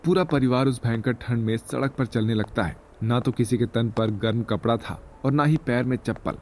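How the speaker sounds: ordinary delivery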